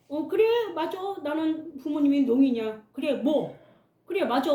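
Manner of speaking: put-on a child telling classmates her parents are deaf, confident; said confidently. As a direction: answer back with full confidence. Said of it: "자신 있게 제가 이야기를".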